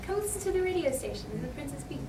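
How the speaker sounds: lightly voiced radio promo as Princess Peach; a quick hint of the Peach commercial read. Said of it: "I'm like the commercial".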